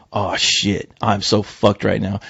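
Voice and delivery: low, soft, resigned inner voice; retold sinking dread. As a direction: act out a doomed realization, slowing down. Retold dread at being caught.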